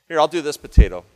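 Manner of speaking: ordinary talk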